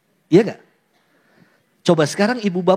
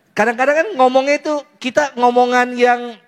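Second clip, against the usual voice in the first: ordinary talk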